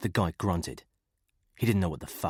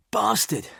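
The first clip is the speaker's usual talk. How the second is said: pained curse; 6 dB louder than usual. A narrator's reading. Stone hurt, cursing.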